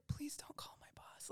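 hushed, desperate begging; whispered pleading. Retold or imitated. Stoned clerk begging customers not to complain, whispered. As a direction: plead in a whisper so nobody hears.

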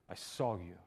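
low, soft, intimate "I saw you"; hushed. Acted. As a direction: say it like a secret. Jesus's intimate line, dropped low.

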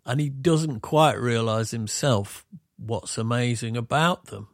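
usual speaking voice

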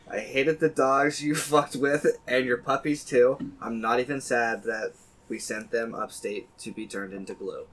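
slow, deliberate mock-solemn recital; poem reading. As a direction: read it like a eulogy in verse, slowly. Reads own pet epitaph poem.

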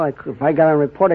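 usual speaking voice

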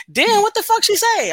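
high, loud, slowed outburst; shocked outburst. Retold or imitated. Mother retorting to daycare provider.

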